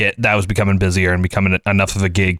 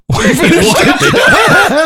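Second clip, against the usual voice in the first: high, loud disbelief breaking into a laugh; shocked wait what; 6 dB louder than usual. Retold or imitated. Coworker retelling his stunned reaction to the cookie kid, laughing disbelief. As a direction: gasp it out like you can't believe what you heard.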